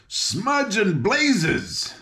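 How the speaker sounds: high, slow, drawn-out angry cry; angry shout. A narrator's reading. King shouting angrily at the second ring. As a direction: stretch out the oath in anger. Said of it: "the king shouted angrily".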